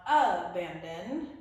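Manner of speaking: abandon with the opening A punched out; stress on first syllable. Shown as a demonstration. Teacher showing what it's not, first syllable of abandon stressed. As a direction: hit the A of abandon hard. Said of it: "It's not abandon".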